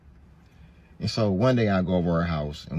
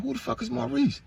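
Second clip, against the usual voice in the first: much higher, outraged disbelief; incredulous; 3 dB quieter than usual. Retold or imitated. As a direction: fire it up high in disbelief. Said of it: "I'm like".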